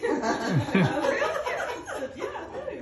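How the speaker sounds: laughing through the words; giggling as she tells it. Real, not acted. Laughing while retelling.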